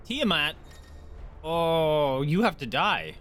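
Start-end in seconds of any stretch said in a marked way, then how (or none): none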